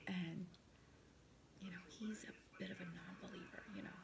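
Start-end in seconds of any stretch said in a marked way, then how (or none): 1.6-3.9 whispering. Real, not acted. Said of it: "I have to whisper".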